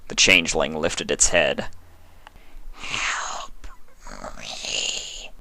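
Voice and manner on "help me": faint unvoiced wheeze; wheezed. A narrator's reading. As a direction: barely breathe out the plea. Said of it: "it wheezed".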